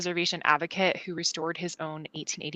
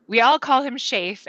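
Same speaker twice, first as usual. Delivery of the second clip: ordinary talk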